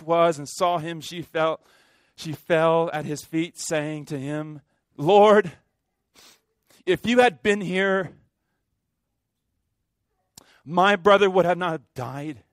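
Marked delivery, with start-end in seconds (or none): 5.0-12.4 loud, pleading cry to the Lord; Mary's anguished plea. Acted. Preacher voicing Mary at Jesus' feet, loud anguished plea. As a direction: cry it out like a grieving sister at his feet.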